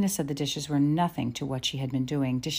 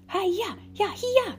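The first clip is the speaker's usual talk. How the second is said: very high, whooping yell; 3 dB louder, slower than usual. A narrator's reading. Lena driving the racing ponies, yelling. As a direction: whoop to drive horses on. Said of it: "she yelled at the ponies".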